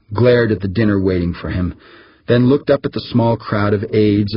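usual speaking voice

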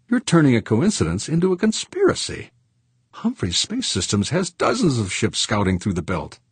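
high-pitched, smoothly dismissive woman; deprecating businesswoman. Acted. Executive dismissing conspiracy talk, high and deprecating. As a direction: brush off the charge in a high voice.

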